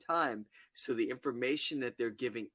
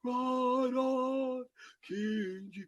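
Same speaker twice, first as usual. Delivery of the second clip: mock soloist; 12 semitones higher, much slower than usual. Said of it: "the soloist comes out".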